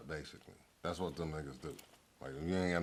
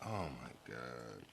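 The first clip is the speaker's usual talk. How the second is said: soft, slow, awed murmur; a hushed retold gasp of disbelief; stunned hush; 7 semitones lower, 11 dB quieter, slower than usual. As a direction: let it slip out under your breath, slowly. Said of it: "I'm like".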